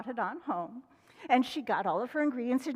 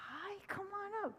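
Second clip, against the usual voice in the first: higher, much softer invitation; soft welcome; 9 semitones higher, 14 dB quieter than usual. Real, not acted. Minister coaxing a child forward, soft.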